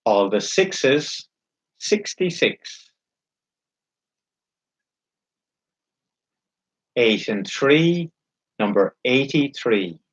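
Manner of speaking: slow, drawn-out bingo calling; bingo caller. Acted. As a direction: call each number slowly, then repeat it.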